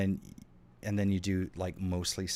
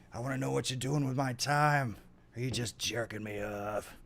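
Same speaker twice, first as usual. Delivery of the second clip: movie character quote; 4 semitones higher than usual. Retold or imitated. Quoting film character line.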